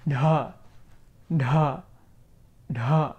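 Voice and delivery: dha, retroflex with a puff of breath; aspirated retroflex dha. Shown as a demonstration. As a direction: breathe out on da with the tongue curled back. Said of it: "we have the sound here".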